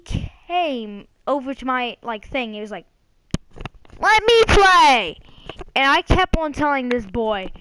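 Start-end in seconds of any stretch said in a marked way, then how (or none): 4.0-5.2 high, loud, drawn-out demand; whine-shout it like a pestering kid, stretched out. Retold or imitated. Kid badgering him for a turn on the Switch, imitated demanding shout.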